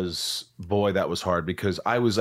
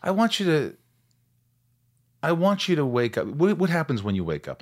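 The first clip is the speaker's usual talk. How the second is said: therapist; 6 semitones higher than usual. Retold or imitated. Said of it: "my therapist actually said".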